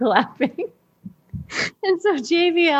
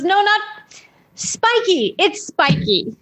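put-on child voice; 9 semitones higher, slower than usual. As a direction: insist like a small boy, high. Son correcting mother.

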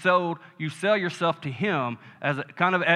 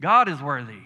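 normal talking voice

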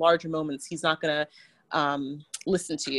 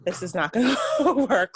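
laughing; 4 semitones higher, 3 dB louder than usual. Real, not acted. Laughing at failed training.

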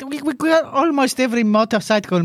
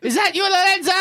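put-on high, suspicious Italian accent; mock-indignant Italian-accented English; Giacomo Agostini; 7 semitones higher than usual. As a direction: demand it like a comic Italian. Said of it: "you need to translate this one".

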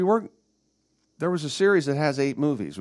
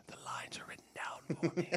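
whispered; 5 semitones higher, 15 dB quieter than usual. Retold or imitated. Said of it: "Ian McKellen explains to Ricky Gervais".

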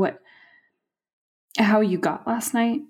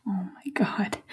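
soft whisper near tears; despairing whisper; 7 dB quieter than usual. Acted. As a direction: whisper it, at the end of her rope. Exasperated wife.

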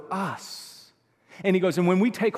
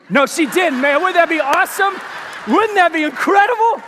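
much higher and louder, gleeful exclaiming; excited delight; 11 semitones higher, 11 dB louder than usual. Real, not acted. Pastor delighting in grandma's imagined retort, gleeful shout. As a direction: relish the imagined comeback out loud.

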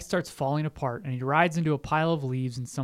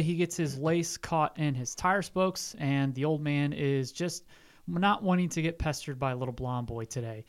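normal talking voice

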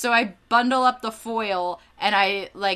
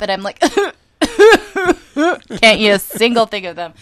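ordinary delivery

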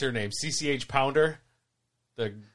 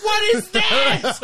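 high, drawn-out yell of disbelief; mock shout; 9 dB louder, much slower than usual. Retelling the friends' reaction to her impression, high mock shout.

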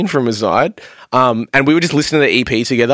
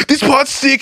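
high, pitched-up excited cry; mock excited yelling; 12 semitones higher than usual. Bandmate moshing in the tour van, mock excited yell. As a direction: imitate yelling along, without much volume. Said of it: "he's, like, yelling the lyrics".

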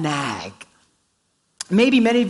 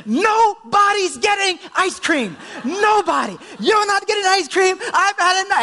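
retold outburst, much higher and louder; exasperated outburst; 12 semitones higher, 4 dB louder than usual. Father loses patience with daughter.

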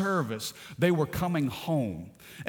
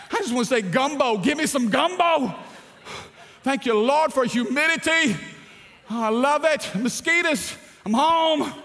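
high, loud, overjoyed cries of being home; joyous homecoming; 9 semitones higher, 5 dB louder than usual. Acted. Preacher to church, hamming up his joy at landing home, loud and high. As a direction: belt out the joy of coming home.

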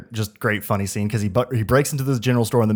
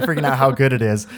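ordinary talk

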